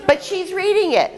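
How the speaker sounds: very high-pitched objection; high, insistent protest. Real, not acted. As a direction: call out the rule-breaker. Protesting a broken game rule.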